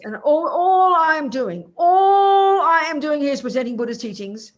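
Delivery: all I am doing, said high and loud, slowly pressed home. Real, not acted. Teacher defends her teaching to students, loud and insistent. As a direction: insist loudly on what she is doing.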